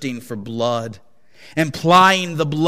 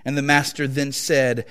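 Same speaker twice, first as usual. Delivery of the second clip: plain reading voice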